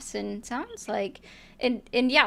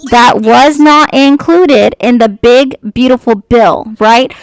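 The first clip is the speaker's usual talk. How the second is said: raised voice; 17 dB louder, slower than usual. Real, not acted. Talking over an interrupting opponent.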